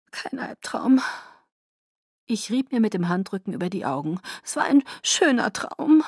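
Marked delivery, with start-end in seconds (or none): 0.1-1.0 high, tearful denial; choked-up, thin voice; voice failing. A narrator's reading. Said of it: "Wieder versagte meine Stimme". 4.4-6.0 high, tearful wail; voice failing. A narrator's reading. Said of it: "Wieder versagte meine Stimme".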